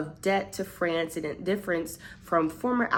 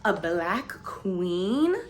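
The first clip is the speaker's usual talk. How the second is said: slow, drawn-out delighted question; intrigued; 2 semitones higher, much slower than usual. Retold or imitated. Recalling finding the book, slow delighted interest. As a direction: savour the discovery. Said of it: "I'm like".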